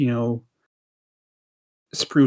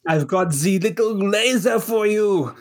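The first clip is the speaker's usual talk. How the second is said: Toy Maker villain; 6 semitones higher than usual. Pretend. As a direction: creep closer and taunt like a playful villain. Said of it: "the toy maker as portrayed by Neil Patrick Harris".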